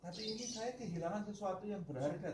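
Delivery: high, insistent pleading; saint's high-pitched reply. Acted. The saint answering the mourners, high and insistent. Saint explains his real grief.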